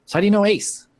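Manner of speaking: pry innocently; Molly's sly question. Acted. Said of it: "she goes".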